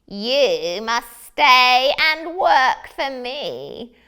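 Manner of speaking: slow, loud, rising to a sung high wail; menacing wizard threat. Acted. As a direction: draw out the threat, ending in a shrieking sing-song.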